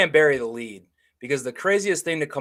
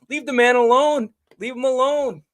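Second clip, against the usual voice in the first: loud, fed-up plea; 9 semitones higher, 6 dB louder than usual. Real, not acted. Podcast host scolds fans to leave the quarterback alone, loud and exasperated. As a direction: raise the voice high and loud, demanding.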